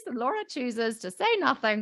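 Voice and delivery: ordinary talk, nothing marked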